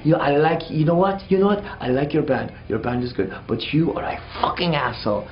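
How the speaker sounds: put-on grudging praise, then the insult, in a French accent; sputtering French-accented tirade; angry French accent. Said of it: "it's like a French dude".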